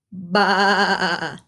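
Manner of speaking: playful mock bleat of a sheep; a hammed-up sheep call. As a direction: baa like a lamb for the kids. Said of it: "Talk like an animal".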